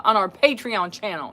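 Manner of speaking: ordinary talk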